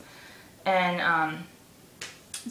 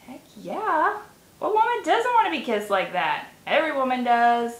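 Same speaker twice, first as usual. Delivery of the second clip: eager delight; 5 semitones higher, 4 dB louder than usual. Retold or imitated. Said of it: "I was thinking".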